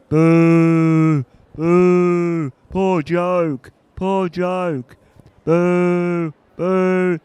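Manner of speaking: loud jeering boos; booing heckler. Acted. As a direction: boo him off the stage.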